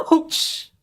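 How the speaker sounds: sudden high yelp of pain; real startled ouch; sharp ouch. Real, not acted. Audio blast hurts ears.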